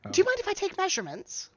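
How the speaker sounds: high, quick, polite request; eager dwarf shopkeeper. Acted. Dwarf tailor asking to measure a customer, eager. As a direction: ask it fast in a high little voice. Game master voices shop dwarf.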